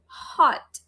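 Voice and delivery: "Hot" with a puff of air on H; breathy H opening "Hot"; airy H. Shown as a demonstration. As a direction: blow the H of "Hot" onto the hand. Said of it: "can you check it again".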